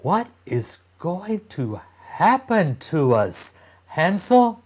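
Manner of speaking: put-on high, anxious child's voice; a scared young sister pleading; frightened little girl. Gretel frightened in the dark.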